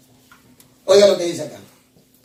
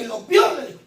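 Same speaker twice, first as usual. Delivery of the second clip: usual speaking voice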